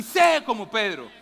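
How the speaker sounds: "sé como Pedro" called out loud and slow; shouted slogan. Shown as a demonstration. Congregation told to say it to the person beside them, loud slow shout. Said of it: "Pero dígaselo así".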